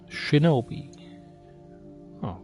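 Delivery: usual speaking voice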